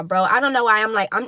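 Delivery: ordinary delivery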